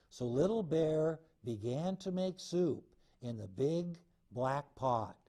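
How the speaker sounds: ordinary delivery, nothing marked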